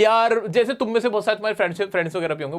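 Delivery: ordinary delivery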